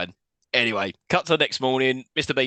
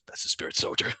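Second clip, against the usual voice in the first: Burt Reynolds impression; 8 dB quieter than usual. Voicing Burt Reynolds' cut line.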